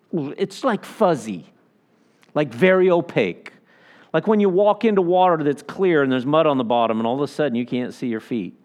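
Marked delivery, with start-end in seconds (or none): none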